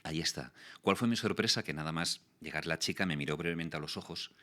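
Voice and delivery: ordinary talk, nothing marked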